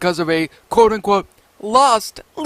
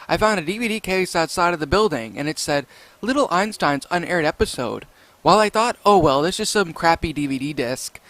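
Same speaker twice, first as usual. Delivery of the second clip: softer, lower story reading; 4 semitones lower, 6 dB quieter than usual. Acted. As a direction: read the creepypasta aloud. Reads the story aloud.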